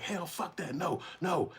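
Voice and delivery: high, stubborn no; high mock woman refusing; defiant refusal. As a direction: refuse them outright in her voice. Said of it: "she was like".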